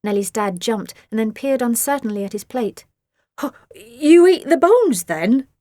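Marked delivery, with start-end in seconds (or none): 3.4-5.5 louder, higher, slow surprised question; jolted dad asking in disbelief; dad stunned at the bone-eating; startled dad. A narrator's reading. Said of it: "he said".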